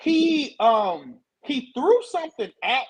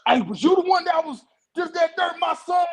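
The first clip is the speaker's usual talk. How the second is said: raised, higher, louder challenge; retelling a boy's angry father confronting him; angry dad; 6 semitones higher, 3 dB louder than usual. Retold or imitated. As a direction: confront the kid's accuser loudly. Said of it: "He said".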